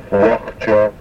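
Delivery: Nachtaka with a German Ach-like throat H; guttural H. Shown as a demonstration. Teacher demonstrating the guttural H, like German Ach. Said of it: "as in the German word Ach".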